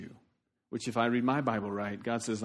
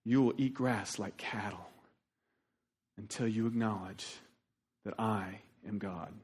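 voice of God; 5 semitones lower, 6 dB quieter than usual. Retold or imitated. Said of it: "and said".

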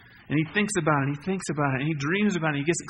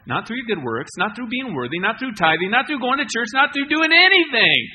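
from level to much higher and louder with each not; building preaching; 5 semitones higher, 8 dB louder than usual. Acted. Pastor to congregation, building each not higher and louder. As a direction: climb in pitch and volume on every line.

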